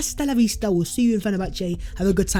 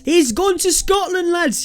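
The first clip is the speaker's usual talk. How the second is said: hammed-up Scottish accent, higher and slower; a deliberately bad Scottish accent; awful Scottish accent; 8 semitones higher, slower than usual. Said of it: "that is an awful accent".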